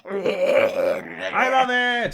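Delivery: stretched out, high and slow with glee; hammed-up joy at new strength; mock ecstatic. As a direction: drag out the delight, way up high. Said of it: "and he's just like".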